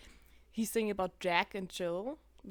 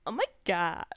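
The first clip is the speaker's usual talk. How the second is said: much higher, sneering voice; mocking bully; 5 semitones higher, 4 dB louder than usual. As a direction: jeer at her like a mean girl.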